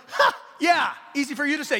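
loud, high mock retort; scoffing retort. Son scoffing at mom's window escape advice, loud sarcastic retort.

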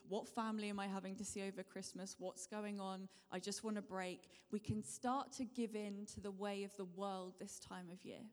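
ordinary talk

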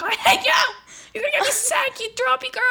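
hammed-up yell at a sick sister; sister's mock shriek, pitched high; imitating sister screaming. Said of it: "My sister was screaming out of the top of her lungs at me".

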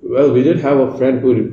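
normal talking voice

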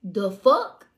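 loud incredulous the fuck; retold shock. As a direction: hit it loud and baffled. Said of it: "And I'm like".

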